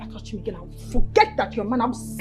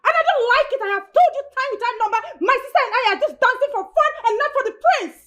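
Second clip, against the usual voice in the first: angry outburst; 7 semitones higher than usual. Acted. Girlfriend shouts at doubting boyfriend.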